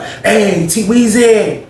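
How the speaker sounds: a cellmate teasing T Weezy from the door; Greg's flirty voice. Retold or imitated. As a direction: coo it high like you're sweet on him. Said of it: "He like".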